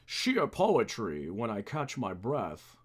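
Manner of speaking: a hint of formal butler; Alfred. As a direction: try a slow butler that settles low. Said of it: "That doesn't sound like him either".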